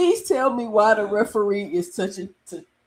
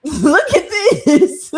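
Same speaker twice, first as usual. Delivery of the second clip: breaks into laughter mid-line, slow and loud; can't get the words out for laughing; 7 semitones higher, 7 dB louder, much slower than usual. Real, not acted. Streamer laughing at a referee clip, laughing.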